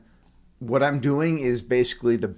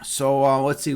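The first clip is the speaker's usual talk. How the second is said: ordinary talk, nothing marked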